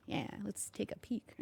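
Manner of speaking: sneaky whisper, slow. Real, not acted. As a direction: whisper as if snooping. Sneaking a look in a window.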